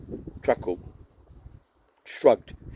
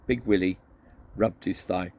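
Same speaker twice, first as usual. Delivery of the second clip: ordinary delivery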